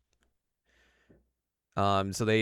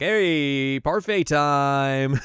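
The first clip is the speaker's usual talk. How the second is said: parfait cheer; 4 semitones higher, 7 dB louder, much slower than usual. Retold or imitated. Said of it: "They're like".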